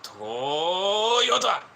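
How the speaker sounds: mock Japanese. Mocking Japanese speakers, loud high brand shout. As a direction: bark out "Toyota!" in fake Japanese. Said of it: "You don't hear Americans talking like that".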